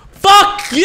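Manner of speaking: screamed high and loud at the monster; furious shout. Real, not acted. Gamer yells at the monster chasing him, furious scream. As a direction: let it rip, pure rage. Gamer attacked by Jack in game.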